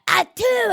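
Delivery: loud, high exaggerated achoo; mock sneeze. Mimicking people who sneeze without covering, loud mock sneeze. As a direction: let out a big uncovered sneeze. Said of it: "a person that be like".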